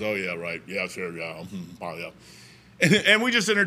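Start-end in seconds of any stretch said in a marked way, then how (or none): 0.0-2.1 sarcastic mumble. Retold or imitated. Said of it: "He goes".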